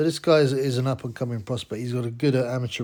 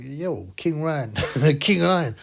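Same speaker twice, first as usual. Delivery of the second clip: put-on Mongolian-accented broken English, a bit louder; mock Mongolian accent; 3 semitones higher, 4 dB louder than usual. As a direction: play the Mongolian fighter teasing in his accent. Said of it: "Tsen Batar says, like".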